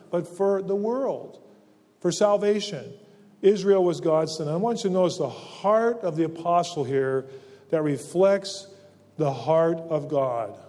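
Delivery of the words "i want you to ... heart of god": normal talking voice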